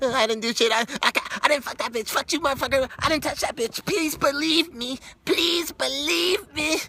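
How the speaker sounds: high, loud, squealing plea of innocence; hammed-up desperate denial in a piercing falsetto; Chris Tucker-style guilty pleading. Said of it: "it sounded like a person that was guilty".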